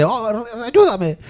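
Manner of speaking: high, very slow drawn-out ayúdame; mock dying plea. Gamer voicing a dying NPC pleading for help. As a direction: moan for help like a dying extra.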